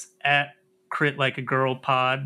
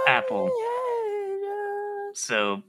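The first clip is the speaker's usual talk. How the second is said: mock tearful plea. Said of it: "Are you crying yet?".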